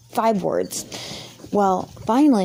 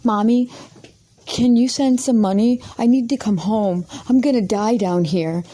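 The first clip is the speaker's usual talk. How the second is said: put-on whiny child; 3 semitones higher than usual. Calling his mommy from the bus station, he whines for money. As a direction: imitate a scared little boy begging. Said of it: "that's what he says".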